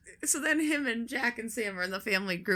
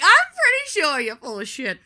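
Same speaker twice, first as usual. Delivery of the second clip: mock exasperation; 7 semitones higher, 10 dB louder than usual. Retorts to son's Avatar claim.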